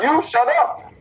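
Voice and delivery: mock heel put-down. Retelling the heel shutting up the referee, hammed up. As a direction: play the villain cutting the ref short.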